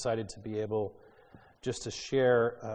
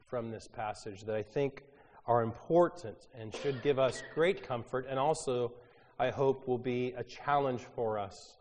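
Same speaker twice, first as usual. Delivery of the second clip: normal talking voice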